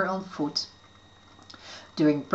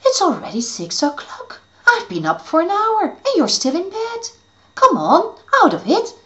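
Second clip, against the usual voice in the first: high, pushy fairy voice; impatient; 10 semitones higher, 3 dB louder than usual. Acted. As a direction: hurry him up, impatient. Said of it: "answered impatiently".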